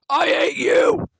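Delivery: very high, drawn-out squealing shout; muffled-sounding hammed-up wail; tearful mock scream. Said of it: "tears are rushing down your eyes".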